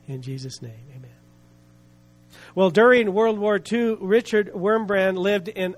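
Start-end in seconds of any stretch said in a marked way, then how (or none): 0.1-1.1 low, unhurried praying voice; prayer. Real, not acted. Preacher leading listeners in prayer before the story, low and reverent.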